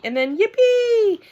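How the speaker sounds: high, loud cheer of joy; yippee. Acted. Girl cheering as the plane moves, delighted. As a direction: squeal with delight at takeoff. Takeoff excitement.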